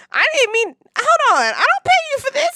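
high, loud, mock-indignant protest; hammed-up indignation; mock offended. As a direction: play up the outrage at the therapist.

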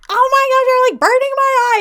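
very high, loud mock panic; freaking out. Woman with paint in her eye, acted freaking out. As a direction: shriek it in hammed-up alarm. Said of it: "she's, like, freaking out".

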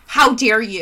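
loud mock indignation; mock pissed-off. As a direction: imitate an outraged girlfriend with a laugh. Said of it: "so pissed".

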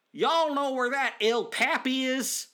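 lightly voiced tourist; mock clueless American. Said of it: "I wasn't like".

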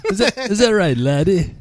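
a hammed-up Scottish laddie voice; Scottish accent. Joining the accent game, a hammed-up Scottish voice. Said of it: "do their best British accent".

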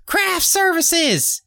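high, drawn-out mock holler; calling out. Director summons food for the star, high drawn-out mock call. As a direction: imitate a director calling out, stretching each word high.

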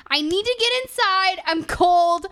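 high, loud complaint; whiny insistence. Retold or imitated. Freezing friend insisting on getting inside, loudly whining. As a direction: wail it with stretched stressed words. Retold pleading to get inside.

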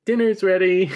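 high, loud sing-out dinner call. Acted. Missus Scott to her guests, calling out dinner.

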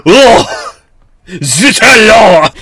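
loud high French cry; hammy French exclamation; French accent. Pretend. Continues mock French bit.

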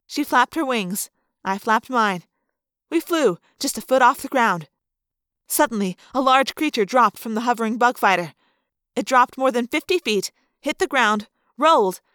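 plain reading voice

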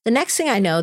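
usual speaking voice

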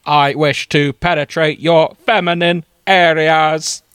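slow, loud, stilted Dalek proclamation; robotic alien wooing, at full volume. Acted. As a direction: declaim each word like a Dalek. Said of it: "I specialise in Dalek voices".

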